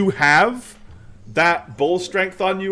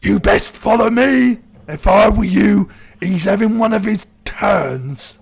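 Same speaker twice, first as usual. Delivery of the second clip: calmer orc; 4 semitones higher, 3 dB louder than usual. Acted. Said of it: "somewhat more calm than the other orcs".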